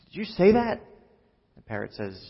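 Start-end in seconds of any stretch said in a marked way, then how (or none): 0.1-0.8 high-pitched, incredulous question; startled burglar. Acted. Burglar questioning a parrot he just found, startled. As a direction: jump up in pitch with disbelief.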